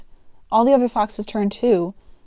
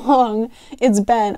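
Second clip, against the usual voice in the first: plain reading voice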